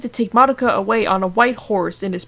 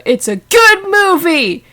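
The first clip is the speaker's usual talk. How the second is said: excited; 10 semitones higher, 7 dB louder than usual. Real, not acted. Aside urging listeners to see film.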